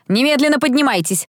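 understated angry matron; furious mistress. A narrator's reading. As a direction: order sleepers up indignantly. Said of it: "разъярённая госпожа Кэлман".

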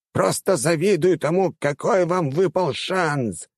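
high-pitched, stretched-out enthusiasm; gushing old rector. Acted. Rector telling teachers he envies them, gushing. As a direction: gush in a high old-man voice, drawing out key words.

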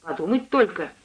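mildly exclaimed "подумать только"; a light, agreeing exclamation. A narrator's reading. Lodger responds to cook's story of her daughter, mildly exclaiming. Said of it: "воскликнул я".

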